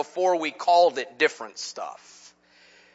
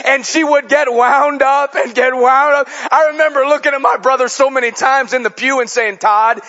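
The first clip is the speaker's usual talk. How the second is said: raised, driving preacher's voice; preaching at full force; 7 semitones higher, 5 dB louder than usual. Acted. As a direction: preach it out to the whole church. Preacher driving the story home.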